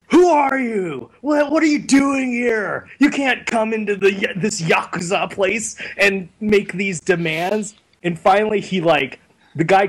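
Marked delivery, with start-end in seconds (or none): 0.1-2.9 hammed-up alarmed demand; mock outraged shout. Acts gangster confronting intruder. 3.0-7.8 higher-pitched mock objection; retold protest, easing off; imitated indignant protest. Gangster's protest continues in story.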